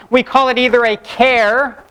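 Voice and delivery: char said with the "ch" of "character". Shown as a demonstration. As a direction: say "char" with a hard ch, like the first syllable of "character". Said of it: "like the first syllable in character".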